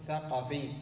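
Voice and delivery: ثقفي with short a after the q: "thaqafī"; fatha on the q. Shown as a demonstration. Teacher giving the correct nisba, ثقفي said "thaqafī". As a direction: say ثقفي carefully as tha-qa-fī. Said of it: "مثل نمر فستقول".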